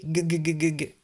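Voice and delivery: rapid repeated hard "g" like chattering from cold; quick run of "guh" sounds for "g"; shivering G. Shown as a demonstration. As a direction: stutter "g, g, g" as if freezing. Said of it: "G a froid et fait g".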